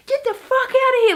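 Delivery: very high, loud mock yell; retold outburst from a rude stranger; imitating dismissive shooing. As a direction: shoo someone away in an exaggerated shout. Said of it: "like, shooing me like a fly".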